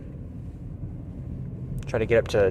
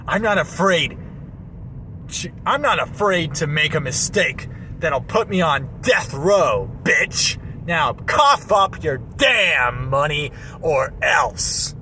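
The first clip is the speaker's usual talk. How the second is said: high tough robber voice; bank robber; 5 semitones higher, slower than usual. Pretend. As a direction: threaten him, high and slow. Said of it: "I could try something like that".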